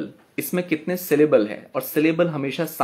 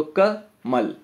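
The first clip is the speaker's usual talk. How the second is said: कमल broken as "ka", pause, "mal"; कमल with a gap after the first syllable; split into syllables; much slower than usual. Shown as a demonstration. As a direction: say कमल in two beats, short ka then mal. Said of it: "मल को एक साथ बोला".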